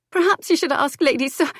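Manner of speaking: high, tearful voice; breaking into a sob. A narrator's reading. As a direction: voice catching on a sob. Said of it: "She broke off on a sob".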